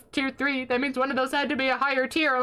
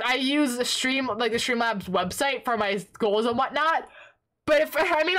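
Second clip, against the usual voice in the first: normal talking voice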